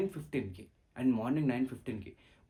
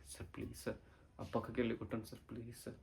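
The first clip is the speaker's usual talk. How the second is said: much softer, pleading under the breath; hushed begging; 3 semitones lower, 14 dB quieter than usual. Acted. Student quietly begs the sir to beat him somewhere else. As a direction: beg quietly so the class won't hear. Begging teacher to hit aside.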